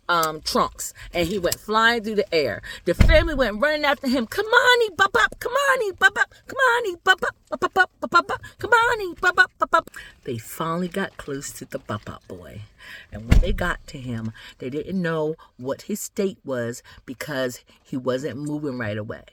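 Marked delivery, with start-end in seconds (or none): 4.3-9.9 high, urgent repeated name-calling; frantic calling. Acted. Family runs after the flying boy, frantic calling. 10.2-19.2 low, soft, slowed narration; hushed suspense. A narrator's reading. Storyteller nears the motionless boy with family, hushed suspense.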